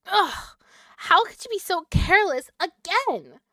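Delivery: high, loud exasperated scolding; angry character outburst. Acted. Character scolds the cook for wasting meat, furious. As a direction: snap at them for being careless.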